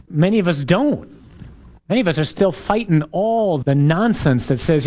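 ordinary talk, nothing marked

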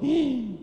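sharp, high intake of breath; dismayed gasp. Acted. Preacher retelling his fear of losing his job, dismayed gasp.

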